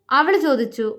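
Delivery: ordinary delivery, nothing marked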